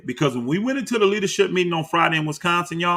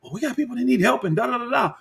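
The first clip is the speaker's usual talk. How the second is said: fast, higher dismissive mimicry; mocking Becker; 8 semitones higher, faster than usual. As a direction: rattle it off like a sales pitch you don't buy. Mocking Becker's rambling pitch.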